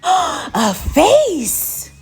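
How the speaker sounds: gasp, then high, loud reveal; startled gasp. A narrator's reading. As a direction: gasp and burst out the surprise. Gasp at suspense climax.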